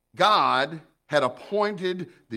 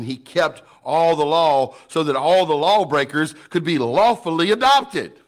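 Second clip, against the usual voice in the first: louder and rising toward a shout; building preaching; 3 semitones higher, 7 dB louder than usual. Real, not acted. Preaching climax.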